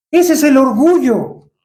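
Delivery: ordinary delivery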